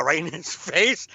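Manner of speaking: ordinary delivery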